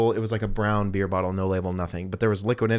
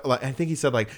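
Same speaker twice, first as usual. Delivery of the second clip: ordinary delivery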